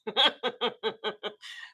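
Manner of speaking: soft laugh; giggle. Real, not acted. Teller giggles recalling the wallet. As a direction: chuckle to yourself.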